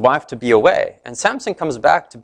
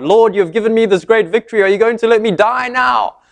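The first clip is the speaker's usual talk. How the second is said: desperate plea; 8 semitones higher than usual. Retold or imitated. Samson calling out to God.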